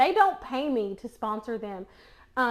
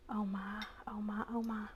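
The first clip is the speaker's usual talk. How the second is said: whispering; 3 semitones lower, 11 dB quieter than usual. Real, not acted. Tasting, awed whisper.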